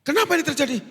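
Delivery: high, pressing question; sailor questioning. Acted. Sailor demanding Jonah's name, high and pressing.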